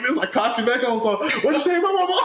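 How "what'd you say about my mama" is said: high-pitched mock shout, laughing; hammed-up outraged demand, voice way up; retold confrontational shout. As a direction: yell it as a challenge, breaking into laughter. Acted confrontation, throwing threat, laughing.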